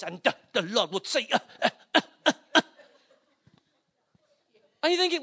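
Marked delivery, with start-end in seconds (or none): 0.0-2.6 stuttering preacher act with loud gasped grunts; mock preacher at the microphone. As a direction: send up a preacher, punching out "uh" between phrases. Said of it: "then when he gets the microphone".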